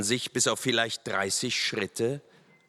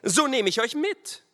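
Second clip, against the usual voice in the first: high-pitched, forceful threat; threatening officer; 3 dB louder than usual. A narrator's reading. Lieutenant threatens to take riders along, stern.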